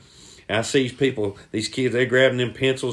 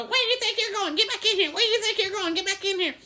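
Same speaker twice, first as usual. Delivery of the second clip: high-pitched hollering coach voice; angry coach; faster than usual. Acted. Coach calling runaway kids from the window, high holler. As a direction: call them back in, strained and high.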